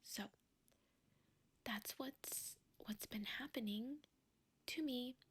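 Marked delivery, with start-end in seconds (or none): none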